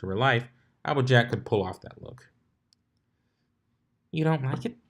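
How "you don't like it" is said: high, lightly voiced teasing question; mock model pose. A narrator's reading. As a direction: play the fashion model, sassy. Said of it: "turned her body like a model".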